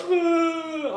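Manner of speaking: high, long whiny wail; drawn-out fake bawl; mock crying wail. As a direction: put on a fake cry before the whipping comes. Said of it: "I would start crying".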